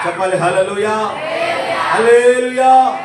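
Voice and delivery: a loud call, the second hallelujah drawn out very slowly; rally the congregation with a stretched cry; shouted hallelujah. Real, not acted. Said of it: "దేవునికి స్తోత్రం చెప్పండి". (సభను ఉత్తేజపరిచే గట్టి హల్లెలూయా కేక)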